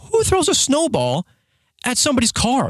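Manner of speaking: incredulous outrage. Retold or imitated. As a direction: stretch it out high in disbelief. Retells his outraged question.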